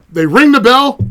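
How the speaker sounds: usual speaking voice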